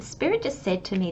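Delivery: ordinary talk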